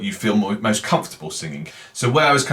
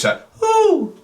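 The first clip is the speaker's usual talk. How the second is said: "whoo" much higher in falsetto; high owl hoot; 4 dB louder than usual. Shown as a demonstration. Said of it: "deliberately going for that higher pitch".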